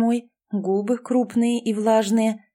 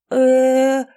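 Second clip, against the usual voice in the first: long drawn-out "э", higher and louder; drawn-out stunned "eh"; 4 semitones higher, 7 dB louder than usual. A narrator's reading. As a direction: stretch the "э" slowly, taken aback. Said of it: "протянула". (протяжное ошарашенное «э»)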